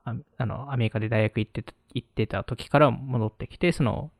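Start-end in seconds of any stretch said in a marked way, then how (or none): none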